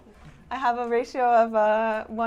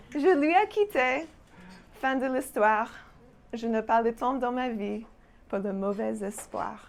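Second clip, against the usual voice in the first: exasperated French phrases; 3 semitones higher, 4 dB louder than usual. Acted. Performing her French phrases poem, fed up and emphatic. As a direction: sigh out each grumble with dramatic fed-up weight.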